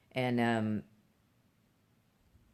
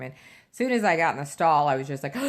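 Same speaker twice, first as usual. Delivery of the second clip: usual speaking voice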